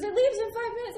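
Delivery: high, loud acted alarm; retold alarmed warning. Airline lady warning the late traveler, high and loud.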